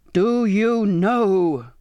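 very slow, drawn-out roar; enraged captain, every word stretched out; furious roar. A narrator's reading. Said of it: "he now roared".

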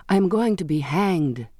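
plain reading voice

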